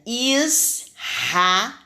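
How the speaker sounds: ISRAEL split into syllables with a strong R. Shown as a demonstration. Teacher has pupils observe the mouth, strong R in "Israel". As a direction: stretch "Israel", R as strong as double R. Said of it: "saiu com som forte".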